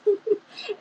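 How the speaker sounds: quick amused laugh breaking into the reading; short laugh. Real, not acted. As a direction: laugh lightly at the story. Reader laughs at Anne's line.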